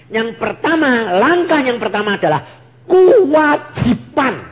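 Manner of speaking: loud, high, pounding sermon delivery; fiery preaching. Acted. Preacher urging the congregation, loud and high. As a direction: preach it with full force to the congregation.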